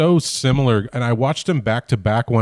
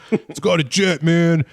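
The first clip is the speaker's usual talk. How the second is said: 1 mock brash American; 7 semitones higher, slower than usual. Joins the American parody.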